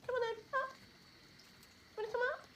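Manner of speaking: high sing-song call to a dog; high pet voice. Real, not acted. Coaxing the dog to come up.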